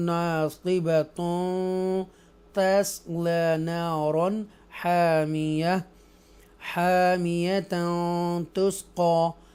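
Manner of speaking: slow melodic tajweed chant; Quran recitation. Shown as a demonstration. Teacher recites the joined reading for students, slow melodic chant. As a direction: recite slowly, joining the words with flowing vowels. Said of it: "या फिर आप पढ़ सकते हैं".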